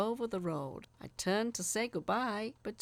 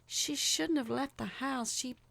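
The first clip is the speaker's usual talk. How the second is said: comforting; 7 semitones higher than usual. A narrator's reading. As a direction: soothe him gently. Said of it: "putting a comforting hand on my shoulder".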